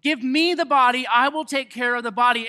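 desperate Mary. Retold or imitated. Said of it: "And she goes".

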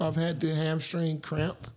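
ordinary talk, nothing marked

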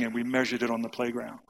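normal talking voice

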